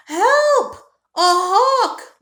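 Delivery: high, loud panicked squeal; frightened cry for help. Acted. Squirrel Pete crying out at the swooping hawk, panicked. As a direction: shriek it like a startled squirrel.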